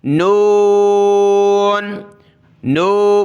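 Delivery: slow نون from the mouth; not through the nose; نون voiced in the mouth; mouth noon. Shown as a demonstration. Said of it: "منہ سے آواز نکالیں، ناک سے نہیں".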